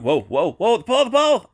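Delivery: high, loud panicked cry as the drone nears the pole; alarmed shout. Real, not acted. Pilot yelling at his drone about to hit a pole, panicked shout. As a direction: yell in real alarm at an oncoming crash.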